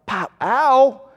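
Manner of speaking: a quick playful pop and yelp of pain; hammed-up ouch; mock ow. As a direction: mimic a smack and a yelp. Acting out physical discipline.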